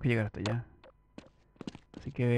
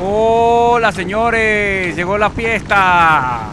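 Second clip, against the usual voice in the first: much higher, louder mocking greeting; triumphant shout; 7 semitones higher, 12 dB louder, much slower than usual. Real, not acted. Gamer greeting enemy soldiers with a gleeful shout. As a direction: hail the soldiers like party hosts.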